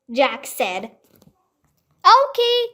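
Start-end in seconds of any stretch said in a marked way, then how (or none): 2.0-2.7 high, louder call into the woods; shout. A narrator's reading. Annie shouting for the puppy in the woods. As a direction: yell his name into the trees. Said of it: "Annie shouted".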